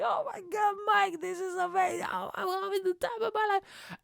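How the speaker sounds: mock screaming girl on a ride; imitating thrilled girl. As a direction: shout it in an ecstatic girlish falsetto. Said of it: "She's loving it".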